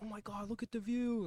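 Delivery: a lightly mocking British accent, marvelling at the view. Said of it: "his British accent".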